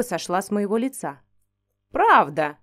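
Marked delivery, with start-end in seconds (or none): 1.9-2.6 low, loud oak voice; talking tree bass. Acted. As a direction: boom it out like an old oak. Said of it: "басом проговорил дуб".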